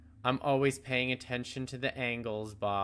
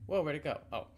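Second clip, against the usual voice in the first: ordinary delivery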